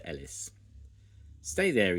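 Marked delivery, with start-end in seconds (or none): 1.4-2.0 louder, higher firm order; firm command. A narrator's reading. Farmer Pickles telling Scruffy to stay, loud and firm. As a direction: tell the dog to stay. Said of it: "he said".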